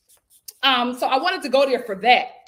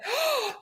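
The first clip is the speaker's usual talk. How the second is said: sharp high gasp of surprise; quick startled intake; surprised gasp; 13 semitones higher, 3 dB quieter than usual. Real, not acted. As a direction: gasp as the idea hits.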